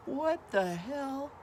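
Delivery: slow, high-pitched dismay; retold stunned reaction, not shouted; upset disbelief. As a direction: stretch it out, aghast. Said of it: "looking very upset, and they go".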